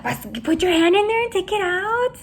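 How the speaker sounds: high, insistent imitation; urging. Imitating Sergio urging his mom, high and insistent.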